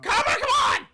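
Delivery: exasperated shout. Real, not acted. Yelling at Copper.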